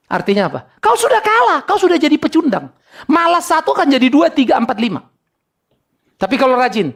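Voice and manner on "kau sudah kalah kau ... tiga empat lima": high, emphatic preacher's rebuke; preaching rebuke. Acted. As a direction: tell them off from the pulpit, pitched high. Preacher rebukes the lazy listener. (teguran pengkhotbah bernada tinggi)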